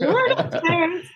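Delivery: high-pitched mock usher call; calling out directions. New crew member sending guests upstairs, high-pitched call. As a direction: sing out directions high, as if clueless.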